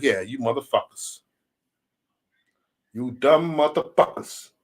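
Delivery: slow, stretched-out insult; drawn-out taunt. Retold or imitated. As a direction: taunt them, stretching each word. Imagined taunt at onlookers.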